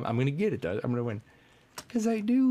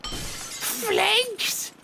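put-on high squeaky girlfriend; slower than usual. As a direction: play the jealous girlfriend flake, shrill and shocked. Flake family voice, upset.

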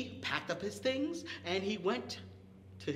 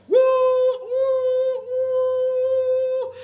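high, loud train whistle woo; 13 dB louder, much slower than usual. Retold or imitated. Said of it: "then the train would come".

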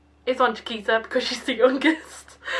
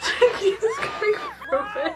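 much higher, slower words through laughter; laughing. Real, not acted. Laughing at Chiquita's fright, squealing the line high and slow.

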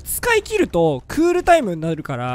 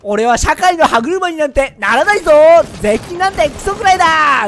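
louder, much higher rebellious yell; defiant young shout; 8 semitones higher, 7 dB louder than usual. Retold or imitated. His nineteen-year-old self rejecting taxes, defiant shout. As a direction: shout it like a cocky teenager. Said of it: "なんてね".

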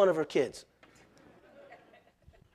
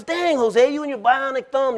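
teasing wife; 9 semitones higher, 4 dB louder than usual. Retold or imitated. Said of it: "She goes".

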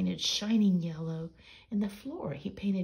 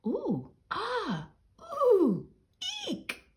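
much higher, squealing with delight; delighted squeals; 5 dB louder, much slower than usual. Acted. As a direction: gasp and squeal in surprise. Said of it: "They cried aloud in dis-, delight and surprise".

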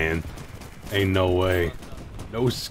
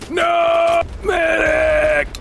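yelling for a medic; 12 semitones higher, much slower than usual. Real, not acted. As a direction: scream for the medic. Calling for a medic.

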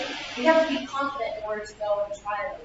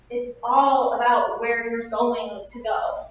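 ordinary talk, nothing marked

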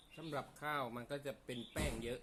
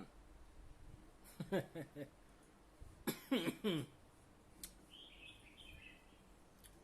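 laughing; 6 semitones higher, 3 dB quieter than usual. Real, not acted. Laughs after joke.

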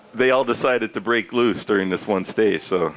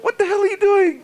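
high, shouted disbelief; mock exasperated retort; 3 dB louder than usual. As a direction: yell back as the exasperated manager. Manager's retort in retold quarrel.

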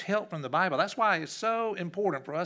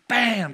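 imitated chair crash; 8 semitones higher, 10 dB louder than usual. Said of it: "It said".

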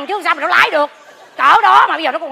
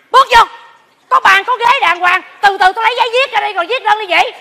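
loud, high commanding call; bossy order; 5 semitones higher, 5 dB louder than usual. Acted. Nun ordering the fighting couple inside, loud bossy call. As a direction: order them to sit and talk.

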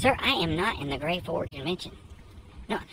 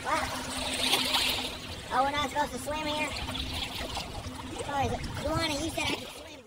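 higher, softer apologetic reply; voicing Joanna; 10 semitones higher, 8 dB quieter than usual. Vlogger voices Joanna apologizing, higher and softer. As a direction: sheepishly plead innocence.

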